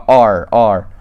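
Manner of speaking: R with the tongue pulled back, curled English R. Shown as a demonstration. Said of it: "that's pronouncing an English R".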